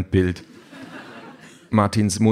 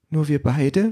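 high, rising sad little question; sad child; 7 semitones higher, slower than usual. A narrator's reading. Child asks mother about leaving without papa, sad. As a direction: ask it as a small child, saddened. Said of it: "fragt das Kind traurig".

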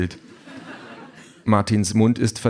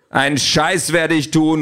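louder, higher, drawn-out refusal; angry shout; 8 semitones higher, 5 dB louder than usual. A narrator's reading. Husband refusing the nanny's request, angrily shouting. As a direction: shout back defiantly, stretching it. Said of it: "ruft Martin".

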